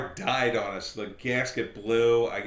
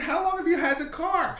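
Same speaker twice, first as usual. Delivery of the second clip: high, stunned disbelief; pitched-up shock at the answer; incredulous. Retold or imitated. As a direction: ask it like you can't believe your ears. Disbelief at her neglect.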